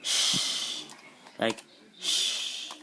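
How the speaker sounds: a voiceless sh, drawn out; just hiss sh with no voice; shushing sh. Shown as a demonstration. Teaching the Shin letter, demonstrating the sh sound. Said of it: "corresponds to S H".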